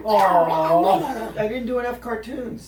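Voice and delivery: high, loud mock dismay; playful "oh no" squawk.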